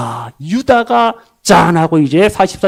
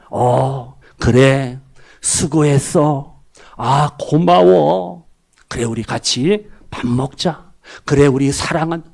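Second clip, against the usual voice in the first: soft, slow and low, full of kindness; tender parent thanking a child at dinner; gentle kind parent; 7 semitones lower, 3 dB quieter, slower than usual. Retold or imitated. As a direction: ease into it like greeting a tired child. Kind parent speaking at the table.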